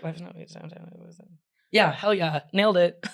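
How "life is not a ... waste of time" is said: barely audible, mumbled; mumbled quiet recital. Shown as a demonstration. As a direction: mutter it under breath. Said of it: "did I do that right?".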